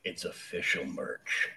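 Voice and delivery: low, slow, soft DJ announcement. Pretend. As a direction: drop it down into a slow DJ voice. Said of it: "That was in your best D J voice".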